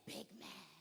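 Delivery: whispered, secretive reply. Retold or imitated. Coach whispering a secret to her players. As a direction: lean in and whisper it. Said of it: "I said".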